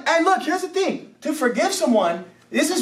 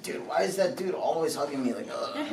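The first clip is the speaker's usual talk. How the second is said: low, muttered grumble; acted put-off complaint, ending in a low groan; mock annoyance; 7 semitones lower, 9 dB quieter than usual. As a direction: grumble it under your breath. Voices person weary of being hugged.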